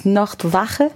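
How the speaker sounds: careful attempt at German in a foreign accent; bad German accent. Real, not acted. Guest trying a German stage name for the host, bad German accent. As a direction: try "Nachtwache" slowly in an accented German. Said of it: "like, in a bad accent".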